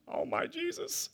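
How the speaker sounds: high, slow, breathy-sounding gasp of awe; awed exclamation. Real, not acted. Preacher marvelling at a church of thousands, awed. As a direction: marvel at the number under your breath.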